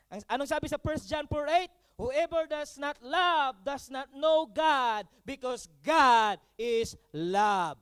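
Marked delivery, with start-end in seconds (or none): 1.9-7.8 very slow, loud verse read from the pulpit; preacher booming out a Bible verse; scripture reading. Acted. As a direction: proclaim the verse slowly and firmly. Pastor reads 1 John 4:8.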